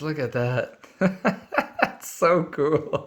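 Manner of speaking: giddy laugh through the words, stretched out; real delighted laughter, drawn slow; laughing with joy. Real, not acted. Admiring the silver play button with delighted laughter.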